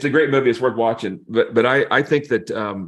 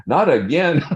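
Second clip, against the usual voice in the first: higher-pitched dismay, half laughing; mock dismay; 5 semitones higher, 4 dB louder than usual. Pastor hearing a psychiatrist is calling, laughing mock dismay. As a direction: groan it jokingly through a laugh. Retelling his dread at the call.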